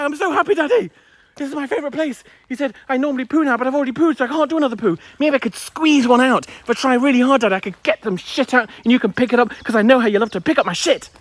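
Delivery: high, silly talking-dog voice; dog's voice. Pretend. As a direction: voice the dog as a giddy child. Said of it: "He's like".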